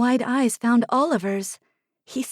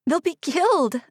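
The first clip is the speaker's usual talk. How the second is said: strained, higher croak; 7 semitones higher than usual. A narrator's reading. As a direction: croak it out in alarm. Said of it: "I croaked".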